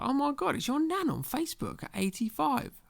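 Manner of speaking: high-pitched imitation of an amazed commenter; mock amazement. Commenters marvelling at an elderly Nan online, mock amazed.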